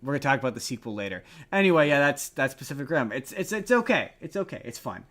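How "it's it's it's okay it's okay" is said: ordinary delivery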